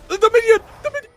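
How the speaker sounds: high, loud urgent yelling; frantic shout. Real, not acted. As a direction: point it out in a panic. Panicking over base steal.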